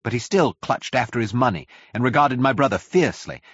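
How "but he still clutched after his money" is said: plain reading voice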